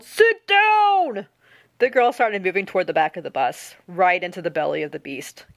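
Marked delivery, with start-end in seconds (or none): 0.0-1.3 high, drawn-out loud yell. A narrator's reading. Bus driver ordering the standing girl to sit, yelling. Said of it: "the bus driver yelled".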